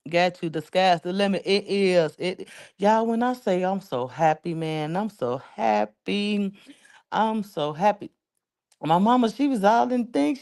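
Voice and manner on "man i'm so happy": normal talking voice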